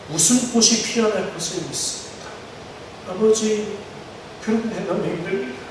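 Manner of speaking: soft, pleading prayer voiced for the believer; hushed prayer. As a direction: pray quietly, as if asking God where He is. Preacher voices a struggling believer's prayer. (조용히 간구하는 기도)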